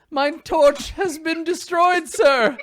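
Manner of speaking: very high, drawn-out, loud report; knight in the back. Acted. Knight to Bluto about his torch, high hammed-up cry. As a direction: wail it up to your commander. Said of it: "one of the knights in the back is like".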